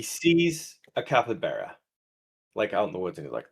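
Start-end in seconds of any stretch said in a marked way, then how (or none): none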